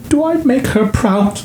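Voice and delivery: high, fragile mock-tearful question; mother asking her son through tears. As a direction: let the question nearly crack. Mom asks tearfully in retold scene.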